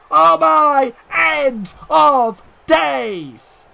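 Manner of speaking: high, loud, hugely drawn-out finisher call; big excited call. Real, not acted. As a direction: stretch each word of the finishing move. Finishing move lands.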